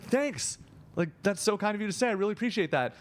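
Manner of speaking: high-pitched, over-polite gratitude; retold awkward thanks, pitched well up. Creator answers a fan's "I love you", awkward high thanks.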